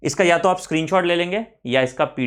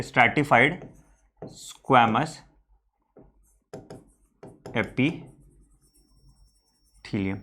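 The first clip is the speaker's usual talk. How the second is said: slow dictation; 2 semitones lower, 4 dB quieter, much slower than usual. Real, not acted. Dictates term while writing it out.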